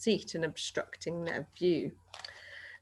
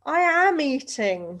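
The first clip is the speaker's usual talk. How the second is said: lightly voiced protesting boy; 8 semitones higher, 7 dB louder, slower than usual. A narrator's reading.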